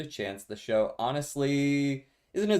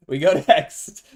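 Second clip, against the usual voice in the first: loud excited catchphrase; 5 semitones higher, 10 dB louder, slower than usual. Real, not acted. Catchphrase while laughing.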